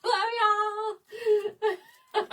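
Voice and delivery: high, loud, breaking into laughter; real laughing fit, words squeezed out; laughing hard. Real, not acted. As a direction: talk through helpless giggles. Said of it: "I'm laughing so hard".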